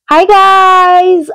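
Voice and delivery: high bright greeting, pitch jumps way up on hi; sing-song hi. Real, not acted. Vlogger greeting her viewers with a high sing-song hi. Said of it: "I was saying hi because I love saying hi".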